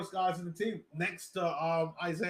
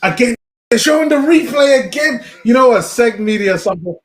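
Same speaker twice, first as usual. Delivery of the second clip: much louder, higher exasperated yell; frustrated shout; 7 semitones higher, 17 dB louder than usual. Real, not acted. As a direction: shout at the stream in annoyance. Frustrated shouting at stream replay.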